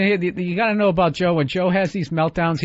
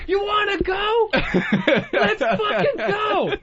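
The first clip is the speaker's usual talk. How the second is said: much higher, slow laughing tough-guy dare; mocking Joe squaring up, laughing tough-guy voice; mock action hero; 13 semitones higher, much slower than usual. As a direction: ham it up like an action movie. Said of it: "like he's in an action movie".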